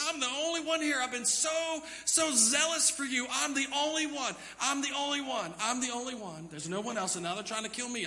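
high, loud, repetitive whine; whining Elijah. Acted. Elijah complaining to God, high insistent whine. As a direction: keep insisting I'm the only one.

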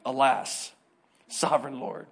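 the preacher mocking the old phrase; quiet mocking repeat. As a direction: echo it back, amused and low. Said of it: "Who says that?".

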